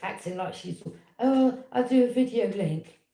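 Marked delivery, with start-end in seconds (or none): 1.2-3.0 high, loud, slow mock sweetness; mimicking mother's fake helpfulness. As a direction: sneer as you imitate her. Mocking mother's pretence.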